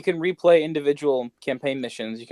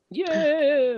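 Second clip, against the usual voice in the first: excited cheer; 12 semitones higher than usual. Real, not acted. Group cheering yeah baby.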